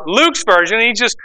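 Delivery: ordinary talk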